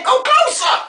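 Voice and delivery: high, fussy stage direction; high calling out. Acted. Star directing at show's end, high and fussy. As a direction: call the order out insistently.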